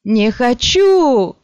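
громкий, медленный, высокий крик; кричит в панике, растягивая; отчаянный крик. A narrator's reading. Раненый отказывается от ампутации. (испуганный крик)

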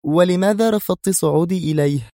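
high-pitched testy question; nervy, annoyed protest; edgy irritation. A narrator's reading. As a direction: demand an explanation impatiently. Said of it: "قالت في عصبية".

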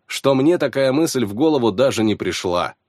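plain reading voice

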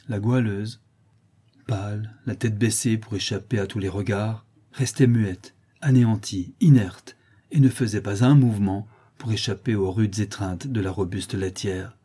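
ordinary delivery

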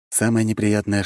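ordinary delivery, nothing marked

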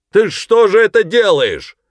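high, loud indignant yell; angry shout. A narrator's reading. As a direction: shout angrily across a distance. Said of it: "сердито крикнул сержант Коллинз". (сердитый громкий крик)

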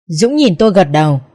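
plain reading voice

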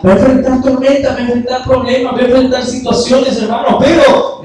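loud, high, rousing sermon voice; fervent preaching. Acted. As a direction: preach it out to the congregation. Preacher builds to full force.

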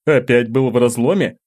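a touch of a stern mentor; teacher voice, knowing question. A narrator's reading. Teacher asks, voiced figure.

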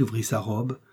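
plain reading voice

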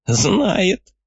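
put-on high snickering old man; cackling old man. A narrator's reading. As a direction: giggle it like a sly old man. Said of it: "хихикнул старик".

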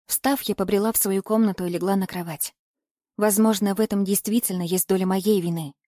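ordinary delivery

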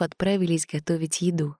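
usual speaking voice

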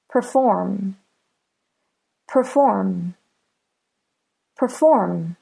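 "Perform" with FORM stressed; stress on last syllable. Shown as a demonstration. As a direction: say "Perform" with a light PER, beat on FORM. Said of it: "The last syllable is stressed".

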